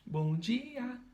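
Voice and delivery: "Bom dia" with DI high and stretched; stress on DI. Shown as a demonstration. Teacher proving the first syllable is tonic, stressed DI in "Bom dia". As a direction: lift and lengthen DI in "Bom dia". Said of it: "a sílaba tônica aí é a primeira".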